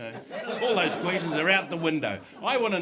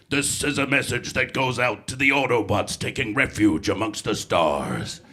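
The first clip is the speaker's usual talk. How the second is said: loud, commanding hero voice slowing down at the end; heroic robot leader; 4 semitones lower, 7 dB louder than usual. Shown as a demonstration. Comedian demoing his hero voice. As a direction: boom it out like a sci-fi hero. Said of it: "I've done heroes as well".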